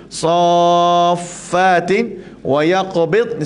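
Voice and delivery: drawn-out Quranic recitation of Safatin wa yaqbid; slow recitation. Shown as a demonstration. Ustaz shows the class, slow chanted recitation. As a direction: recite slowly, stretching each syllable. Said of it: "di sini pun sama juga".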